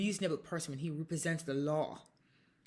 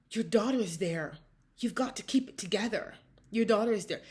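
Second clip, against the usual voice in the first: raised, insistent mock scolding; imitated admonishment; 4 semitones higher, 5 dB louder than usual. Police commissaire tells a mother to keep it together, imitated admonishing. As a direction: imitate an official urging composure.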